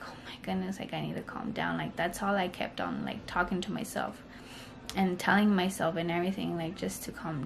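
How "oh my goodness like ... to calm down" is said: whispered to herself, softer. Retold or imitated. Shaking mother whispering to herself on the operating table. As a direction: breathe it out as a private whisper.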